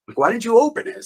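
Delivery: high, disbelieving question; half-laughing incredulous why. Retold or imitated. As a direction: squeak it out in disbelief. Retelling reaction to girlfriend.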